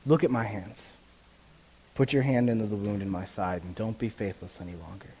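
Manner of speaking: lightly voiced gentle Jesus; gentle invitation. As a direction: speak it tenderly, no rebuke. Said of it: "he said to Thomas".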